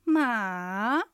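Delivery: long "まあ" falling, then climbing; third tone. Shown as a demonstration. Teacher demonstrates third tone, dipping then rising. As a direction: let "まあ" sag, then lift it. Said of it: "下がって上がる音です".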